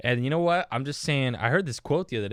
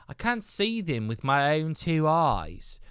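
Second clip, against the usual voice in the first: put-on sudden higher, louder British accent, slow; 7 semitones higher, 5 dB louder, slower than usual. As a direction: drop into a posh British accent for one line. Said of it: "I don't know why I went British for that".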